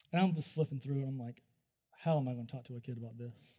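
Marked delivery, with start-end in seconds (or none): 2.0-3.6 low, soft and quick; muted dismay. Retold or imitated. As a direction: say it under your breath, at a loss. Retold dismay at the books.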